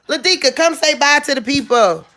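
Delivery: high mama voice calling the dog; mommy voice. Real, not acted. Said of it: "my mommy voice".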